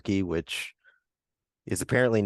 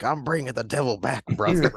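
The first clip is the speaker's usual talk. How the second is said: hyped wrestler promo, fast and high; wrestling promo voice; 4 semitones higher than usual. Retold or imitated. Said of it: "it also sounds like wrestling".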